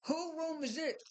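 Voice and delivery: high, slow puzzled question; surprised question. Retold or imitated. Giselle asking whose room, high and puzzled. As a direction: ask it like you can't believe it.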